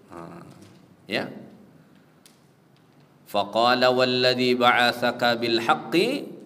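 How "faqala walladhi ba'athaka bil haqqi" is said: slow, drawn-out Arabic chant; Arabic recitation. Acted. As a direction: intone the hadith slowly and solemnly.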